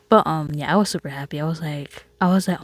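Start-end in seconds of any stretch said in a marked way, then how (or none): none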